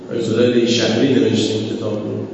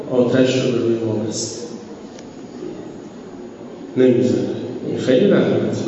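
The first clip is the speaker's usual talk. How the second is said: ordinary delivery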